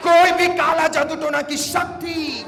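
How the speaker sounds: shouted deliverance prayer, high, in slow separate phrases; preaching at full force. Real, not acted. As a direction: command it line by line for the crowd to repeat. (ऊँची आवाज़ में आदेश भरी प्रार्थना)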